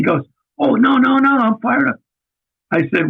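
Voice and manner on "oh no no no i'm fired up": hasty protest. Retold or imitated. Boss assuring a candidate he's keen, high retold protest. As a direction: imitate a high-pitched, insistent denial.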